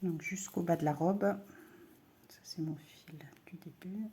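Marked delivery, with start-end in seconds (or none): 2.3-4.1 whispered aside. Real, not acted. Aside while working the yarn.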